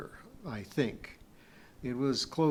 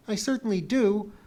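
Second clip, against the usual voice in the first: high, loud indignant retort; indignant objection; 9 semitones higher, 7 dB louder than usual. A narrator's reading. Pee-wee minding Kip's going back, indignant. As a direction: object firmly, pitch jumping up.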